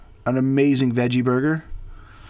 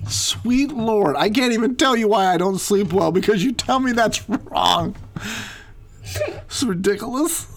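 exasperated; 6 semitones higher than usual. Real, not acted. Exasperated husband complaining.

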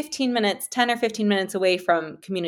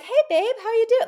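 much higher, sugary greeting; sweet fake cheer; 14 semitones higher than usual. Girlfriend answering her angry boyfriend with a high, sugary greeting. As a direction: sing it out sweetly to calm him. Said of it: "in that".

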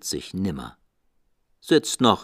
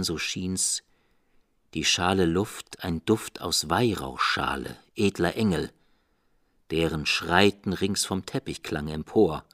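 ordinary delivery

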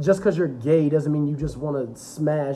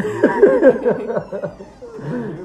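loud laugh; 8 semitones higher, 6 dB louder than usual. Real, not acted. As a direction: burst of high laughter. [name] arrives, big laugh.

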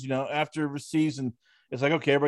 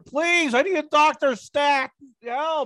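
much higher and louder, mock desperate begging; panicked plea; 11 semitones higher, 5 dB louder, slower than usual. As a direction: pretend you're freaking out at the front desk. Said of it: "I'd be going".

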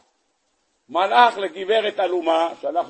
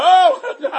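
loud burst of laughter; 13 semitones higher, 7 dB louder than usual. Real, not acted. Laughing at the wife's excuse.

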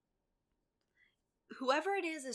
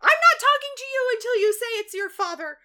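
very high, loud mock tirade; acted yelling; 13 semitones higher, 12 dB louder than usual. Emma demanding Neil admit it, acted yelling. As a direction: shout the whole refusal in one high, hammed-up burst. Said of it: "lots of yelling in this episode".

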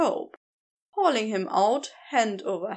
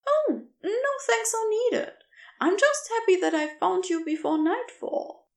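higher, lighter voice for the kind stranger; gentle rescuer voice; 10 semitones higher than usual. Acted. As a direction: offer your name brightly, a little quicker. Narrator voices Aziraphale.

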